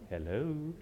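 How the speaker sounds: lightly imitated sweet southern hello, with a laugh; a hint of a gracious southern lady answering. Mother answering the phone after yelling, a touch of sweet southern charm. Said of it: "in that sweet southern voice".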